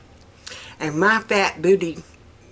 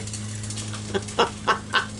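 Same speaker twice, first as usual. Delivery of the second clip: short breathy run of chuckles; real laughing; 8 dB quieter than usual. Real, not acted. Laughing instead of crying about the fall, breathy chuckles. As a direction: chuckle helplessly. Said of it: "I don't know why I'm still laughing".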